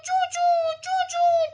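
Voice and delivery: very high mouse squeaks; squeaking. A narrator's reading. The mouse scurries over, high squeaks. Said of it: "કરતો ઘડીક વારમાં જ હાજર".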